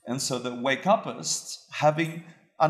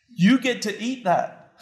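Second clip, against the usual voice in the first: emphatic punchline; 10 semitones higher, 6 dB louder than usual. Real, not acted. Punchline to the listeners.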